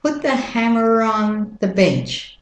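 American vowels, "on" said like "ahn"; American-accent model sentence. Shown as a demonstration. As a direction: link "hammer on" with an American R. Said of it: "And the American accent".

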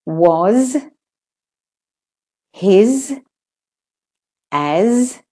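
"was, his, as" each ending in voiced z; word-list model, final z on "was, his, as". Shown as a demonstration. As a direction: buzz the last sound of "was, his, as". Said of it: "where the S says Z".

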